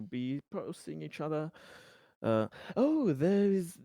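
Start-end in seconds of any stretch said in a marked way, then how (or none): 2.8-3.8 higher and louder, suddenly remembering; excited announcement. Pretend. Surveyor inviting colleagues to karaoke night, excited announcement. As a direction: break into an eager, raised announcement.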